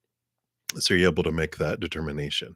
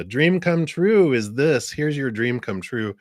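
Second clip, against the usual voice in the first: slow, high, loud presenting voice; grand announcement; 7 semitones higher, 3 dB louder than usual. Pretend. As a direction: hand it over like a gift. Playfully announcing the gift of a dream.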